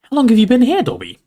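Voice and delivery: voiced questioner. A narrator's reading. Harry asks the elf over tea, voiced question.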